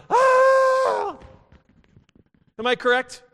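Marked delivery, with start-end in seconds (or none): 0.1-1.2 high, loud groaning shout; mock exhausted yell. Youth group kids crying out, tired from pushing a ball. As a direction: cry out like kids worn out by a heavy ball. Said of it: "they're like".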